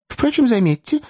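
high-pitched hasty correction; hurried self-correction. A narrator's reading. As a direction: rush to correct yourself. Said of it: "поспешил поправиться мистер Пиквик".

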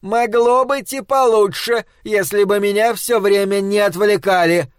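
high, grumbling complaint; wife growls about distractions; growling. Acted. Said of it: "прорычала она".

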